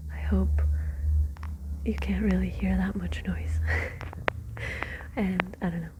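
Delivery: whispered storytelling, every word unvoiced; ASMR whisper. Real, not acted. Whispering Dracula aloud to listeners winding down, ASMR whisper. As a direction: read the book in a steady ASMR whisper.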